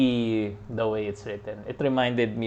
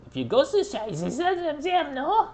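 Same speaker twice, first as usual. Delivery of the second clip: high, slow mock German accent; sing-song German-accented question; playful mock German accent; slower than usual. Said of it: "Marlock's accent here is French or German".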